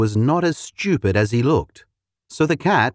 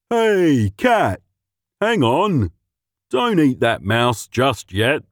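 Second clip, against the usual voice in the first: mutt dog voice; slower than usual. A narrator's reading. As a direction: stop the cat with a bark.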